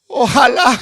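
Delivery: high sobbing wail, slow; crying out. Acted. Beggar cries out in regret over his rice, high sobbing wail. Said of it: "empezó a gritar".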